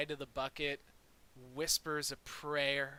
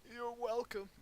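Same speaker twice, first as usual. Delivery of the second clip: put-on old man voice; 10 semitones higher than usual. Parma answers thanks in character.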